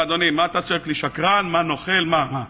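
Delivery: put-on low-pitched, puzzled older man; elderly vendor. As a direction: answer the accuser in a low, baffled voice. Elderly vendor answers the shouting.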